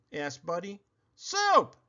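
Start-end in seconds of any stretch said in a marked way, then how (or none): 1.1-1.8 much higher, a hint of snarl; mild snarl. Varmint snarling at Buddy, mildly. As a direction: growl the word out. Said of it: "snarled the scruffy varmint".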